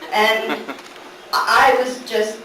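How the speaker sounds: normal talking voice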